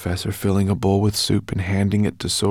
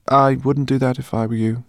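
higher, louder friendly warning; warning professor; 6 semitones higher, 6 dB louder than usual. Acted. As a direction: warn her kindly. Professor warns.

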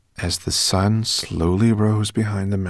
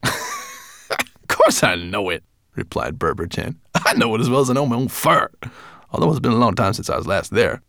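higher, quick boastful brownie; boasting brownie; 4 semitones higher, 3 dB louder than usual. A narrator's reading. Brownie boasts to Firedrake, higher and quick. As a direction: brag about it.

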